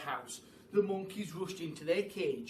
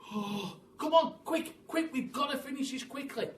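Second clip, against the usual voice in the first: high rushed urging; urgent hurry; 9 semitones higher, 4 dB louder than usual. Acted. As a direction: hurry everyone on. Urgent hurry before Twits return.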